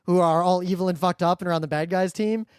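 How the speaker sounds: ordinary delivery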